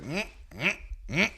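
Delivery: high strained humming grunts. Retold or imitated. The stuck drunk driver grunting, strained mm mm. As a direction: grunt like a man wedged between two cars.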